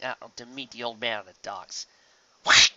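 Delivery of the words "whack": usual speaking voice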